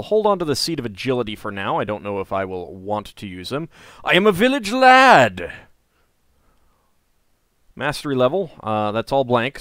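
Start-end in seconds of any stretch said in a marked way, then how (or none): 4.0-5.5 high, loud heroic boast; proud hero voice. Acted. Proudly declaring role.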